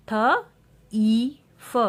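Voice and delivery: थ, ई, फ punched separately; sounding out. Shown as a demonstration. Teacher showing IE words, sounding out थ, ई, फ. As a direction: say थ high and loud, then ई, then फ. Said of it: "हम ई sound use".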